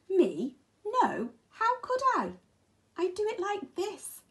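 high, bright-eyed innocent denial; hare's voice. Acted. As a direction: squeak it out like a small hare protesting. Hare answers the mole.

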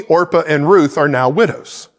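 usual speaking voice

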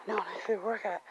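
usual speaking voice

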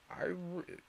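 plain reading voice

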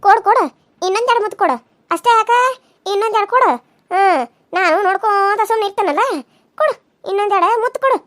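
loud, high-pitched taunting demands; jealous outburst. Acted. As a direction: explode at the kissing couple with bitter sarcasm. Jealous wife bursts out at kissing.

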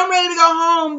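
high, loud sobbing wail; retold crying, hammed up and loud. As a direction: wail it out like she's breaking. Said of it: "She was like".

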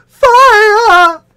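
shouting fire. Acted. Matilda yelling to the street, piercing shout. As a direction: scream it for help. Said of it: "every time she shouted".

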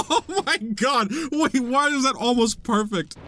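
high delighted laughter; giddy cackle of success; laughing. Real, not acted. Laughing as it works.

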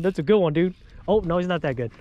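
ordinary talk, nothing marked

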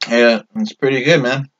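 talking between bites; words muffled by food; mouth full, chewing. Real, not acted. Seen 0: Bites the chalupa and chews through the first words, then clears.

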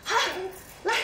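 ordinary talk, nothing marked

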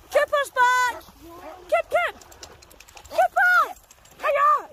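very high, loud squeal of a name; delighted greeting. Real, not acted. Dog walker squealing hello to Cooper. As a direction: gush over the dog like he's a long-lost friend.

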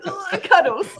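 high, playful imitation of a quick reply; mock gasp, then a laughing answer; instant blurted answer. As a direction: mimic someone blurting it out. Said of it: "the quickness that that one came".

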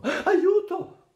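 put-on a terrified boy shrieking at a statue; scream for help. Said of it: "urlò Busa Piccolo". (urlo spaventato, molto più acuto e forte)

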